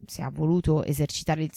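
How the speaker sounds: plain reading voice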